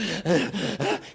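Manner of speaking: mock out-of-breath panting; huffing and puffing. Guy riding a bicycle, acted huffing and puffing. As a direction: puff hard like a winded cyclist. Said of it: "he's huffing and he's puffing".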